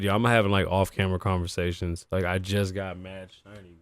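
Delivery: normal talking voice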